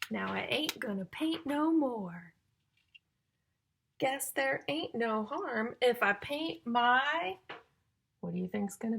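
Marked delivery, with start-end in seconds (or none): 0.1-7.4 loud, slow, sing-song storybook reading; reading to children. Acted. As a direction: build suspense before each rhyme. Reading rhyming picture book to children.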